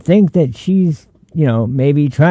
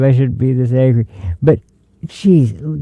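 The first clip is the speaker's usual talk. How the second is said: normal talking voice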